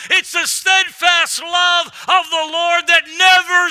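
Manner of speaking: loud, high fired-up sermon voice; full-force preaching. Acted.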